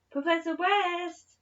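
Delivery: loud high call from afar; a loud, high, far-off call for the professor. A narrator's reading. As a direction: call his name across the corridor. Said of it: "called a familiar voice".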